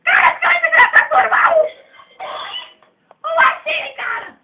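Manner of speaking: loud, high squeaky yelling; excited shout. Acted. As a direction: yell it at full volume. Shouting in raised voice.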